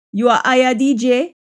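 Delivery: Jamaican patois question. Shown as a demonstration. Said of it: "patois version of the line".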